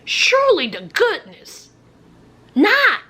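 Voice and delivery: ordinary talk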